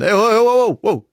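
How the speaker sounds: high, loud volley of heys; loud protest. Acted. Siblings stopping his attempt at Skåne dialect, loud protest. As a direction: cut him off with loud, high objections.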